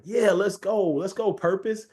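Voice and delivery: rally yourself like a coach on the sideline; pumped up. Retold or imitated. Hyped about program finally rolling.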